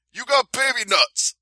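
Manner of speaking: high-pitched mocking taunt; mock trash talk. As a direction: hurl a jeer in a put-on high voice. Mimics press conference trash-talk insult.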